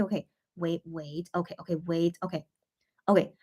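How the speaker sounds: ordinary delivery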